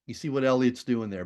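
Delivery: usual speaking voice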